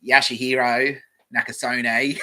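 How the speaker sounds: broad Australian Bogan twang, slow and loud; Aussie Bogan accent. Shown as a demonstration. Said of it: "If I read it like a Bogan".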